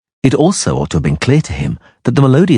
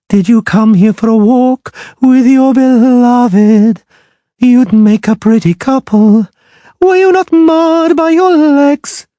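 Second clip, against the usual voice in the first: high, slow, singing mermaid mocking the witcher; a proud sea creature sneering in song; scornful mermaid; 5 dB louder, slower than usual. A narrator's reading.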